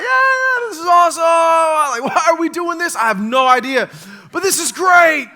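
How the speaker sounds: high, loud, thrilled yelling from under the pile; giddy excitement. Retold or imitated. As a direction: shout it like a kid having the time of his life. Kid buried in dogpile, loving it.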